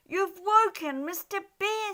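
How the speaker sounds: high rabbit voices. Acted. Rabbits warning the partying animals, high. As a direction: pipe it up like small rabbits. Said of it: "said the rabbits".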